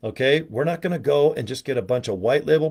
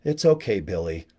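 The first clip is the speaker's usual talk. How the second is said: consoling coach; 6 semitones lower, 5 dB quieter than usual. Retold or imitated. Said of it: "where your coach is".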